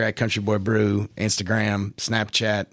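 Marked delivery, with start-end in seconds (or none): none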